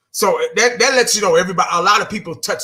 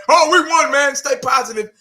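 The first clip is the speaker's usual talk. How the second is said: mock fan voice; 9 semitones higher, 4 dB louder than usual. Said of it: "there's some Cowboy fans out there".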